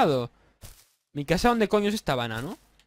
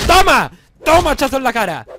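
loud, gleeful yell on each hit; triumphant shout; 7 semitones higher, 8 dB louder than usual. Real, not acted. Gamer yelling at the wolf as he strikes it, triumphant shout. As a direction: cheer as the axe lands.

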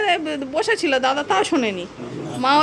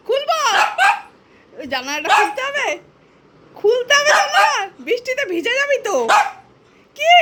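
high, sing-song coaxing voice to the cat; baby talk to a pet; 10 semitones higher than usual. Acted. (বিড়ালের সাথে উঁচু গলায় আদুরে কথা)

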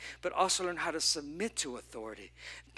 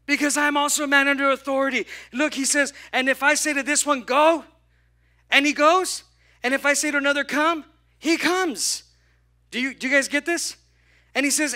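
much louder and higher, proclaiming; full-force preaching; 8 semitones higher, 9 dB louder than usual. Acted. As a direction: thunder it from the pulpit.

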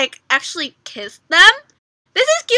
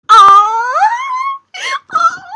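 loud, high aww; 9 dB louder than usual. Real, not acted. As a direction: coo loudly at the sweet moment. Fan cooing over hands.

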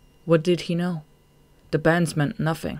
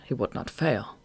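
ordinary delivery, nothing marked

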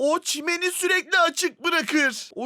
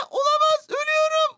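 panicked; 9 semitones higher, faster than usual. Real, not acted. Oyunda ölmek üzere panik.

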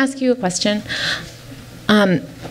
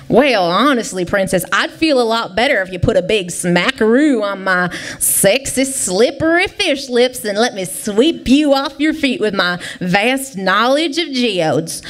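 southern man fish; 2 semitones lower than usual. Acted. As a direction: sweet-talk her in a put-on southern twang. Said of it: "it's a southern fish".

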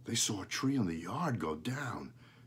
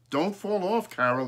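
cried out; 10 semitones higher, 6 dB louder than usual. A narrator's reading. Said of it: "cried her father".

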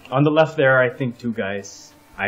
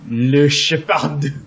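drawn-out, higher mock French; French accent; 4 semitones higher, 4 dB louder, much slower than usual. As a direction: stretch it out in fake French. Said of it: "I was just doing French".

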